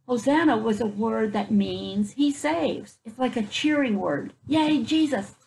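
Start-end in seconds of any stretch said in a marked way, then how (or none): none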